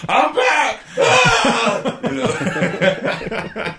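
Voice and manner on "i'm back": high, loud "I'm back" yell; excited mock shout. Friend joking about returning to a drug, mock triumphant shout. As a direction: burst it out loud and high, half laughing. Acting out failed retry of drug.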